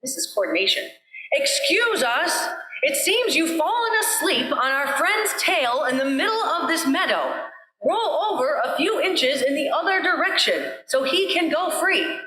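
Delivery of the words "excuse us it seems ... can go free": yelling together. A narrator's reading. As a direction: shout it all together at the ear. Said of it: "they yelled out together".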